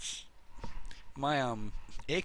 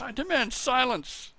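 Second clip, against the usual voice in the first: mock stern husband; 11 semitones higher, 3 dB louder than usual. Husband voiced scolding wife mid-show.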